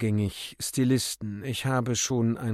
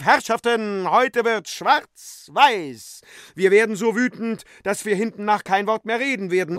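much higher, louder rallying call; 9 semitones higher, 8 dB louder than usual. Acted. Euphemia calls out loud to the flying crowd. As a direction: proclaim it loud to the whole crowd. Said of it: "und rief".

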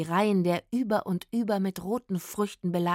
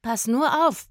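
louder, higher cautioning call; warning; 5 semitones higher, 6 dB louder than usual. A narrator's reading. Zora warns Rufus from the neighbouring tree, warning. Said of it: "warnte ihn Zora".

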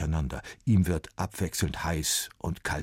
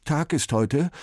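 slow, higher, faltering and stuttered; trembling voice; 10 semitones higher, 6 dB louder, slower than usual. A narrator's reading. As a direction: ask with a shaking voice. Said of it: "mit zittriger Stimme".

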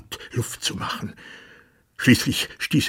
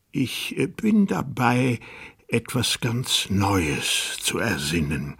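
dazed; 4 semitones higher, slower than usual. Acted. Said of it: "vom Wein ganz benommen und verwirrt".

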